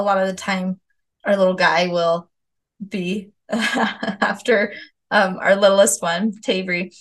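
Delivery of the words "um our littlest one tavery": normal talking voice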